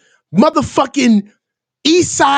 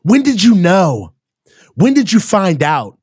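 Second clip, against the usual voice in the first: mock reporter; 7 semitones lower than usual. Mocking reporters' questions.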